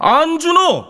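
high, loud call of the name; angry shout. Acted. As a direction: snap his name across the room. Bully shouts classmate's name.

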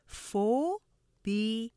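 "phobia" as pho, bi, a, slowly separated; syllable by syllable. Shown as a demonstration. Teacher breaking down a hard word, "phobia" in three slow syllables. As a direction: sound out each beat of "phobia", long pho. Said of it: "이렇게 오음절로 발음돼요".

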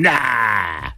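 ordinary talk, nothing marked

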